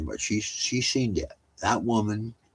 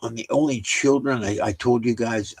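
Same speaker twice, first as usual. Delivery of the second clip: ordinary talk, nothing marked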